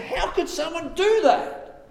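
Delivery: high, slow indignant cry; high outraged question; outraged David. Acted. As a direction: disbelief at the cruelty. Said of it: "Just like David said".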